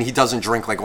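normal talking voice

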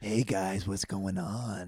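slow, low-key video greeting voice; Instagram video guy. Acted. Guest plays his Instagram persona, drawn out. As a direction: stretch it out like an influencer persona. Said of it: "one of them is the Instagram video guy".

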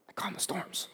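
whispered reminder. Retold or imitated. Jesus reminding disciples of his power, whispered. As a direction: breathe it out in a whisper.

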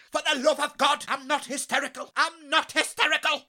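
high, frantic protest; hysterical. Acted. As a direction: insist you're calm, frantically. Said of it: "he's hysterical".

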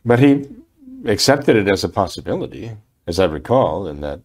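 ordinary delivery

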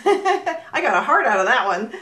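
usual speaking voice

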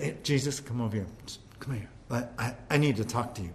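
low secretive murmur, quick at the end; Peter's hushed aside. Acted. Peter pulls Jesus aside to talk privately, hushed and urgent. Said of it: "Peter said".

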